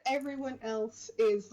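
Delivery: usual speaking voice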